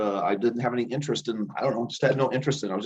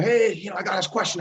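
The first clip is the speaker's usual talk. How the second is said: higher and louder, bursting to ask; eager excitement; 11 semitones higher, 4 dB louder than usual. Retold or imitated. Excited student rushing up to the old teacher at the break. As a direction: blurt the question out like an excited kid. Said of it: "I'm all excited".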